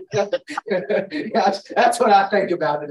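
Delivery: amused laugh breaking into the words. Real, not acted. Teacher laughing after someone barked, then amused.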